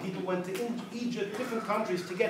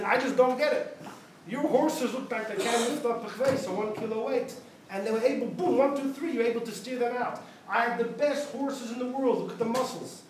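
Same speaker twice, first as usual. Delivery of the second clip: raised, higher-pitched exasperated complaint; 4 semitones higher than usual. Retold or imitated. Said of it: "he turns around exasperated".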